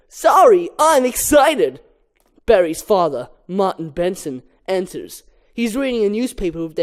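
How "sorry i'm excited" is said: Barry voiced. Barry apologizes to mother.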